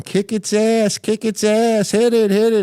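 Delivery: high, urging mock yell; imitated yelling. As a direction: pitch it way up like a firefighter urging the hose. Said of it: "I heard this guy McCarthy yelling".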